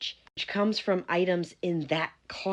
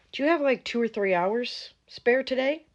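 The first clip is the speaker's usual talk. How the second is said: fake sweet; 9 semitones higher, 5 dB louder than usual. Boss fishing for free work.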